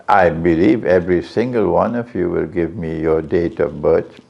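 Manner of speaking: usual speaking voice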